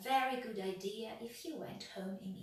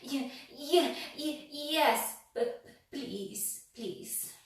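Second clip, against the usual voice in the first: high, loud shaky stutter; quivering stammer; 5 semitones higher, 8 dB louder, much slower than usual. A narrator's reading. Mister Jelly trembling, stammering yes to the tramp. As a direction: stammer yes, then plead. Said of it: "quivered and shook".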